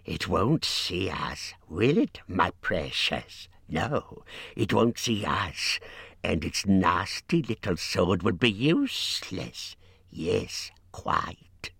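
slow, hissing creature muttering to itself; whispering creature. A narrator's reading. Creature plots to himself about his precious, hissing whisper. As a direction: stretch the words and hiss every s. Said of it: "he whispered to himself".

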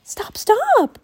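put-on high, urgent girl's plea; pleading child, pitched very high; distressed cry. A narrator's reading. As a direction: cry out to stop them. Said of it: "cried Annie".

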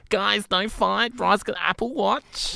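high, loud, slow silly voice; exaggerated high accent, stretched words; annoying put-on voice. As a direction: plead in a drawn-out mock squeal. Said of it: "most annoying, like, voice or accent".

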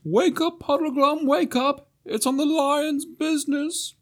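high owl voice calling out; high hooting owl voice, slow at the end. A narrator's reading. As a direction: call like a small owl. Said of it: "Glimfeather was calling".

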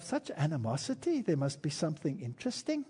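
soft, musing aside; hushed recalled thought; thinking to oneself. Retold or imitated. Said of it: "I thought to myself".

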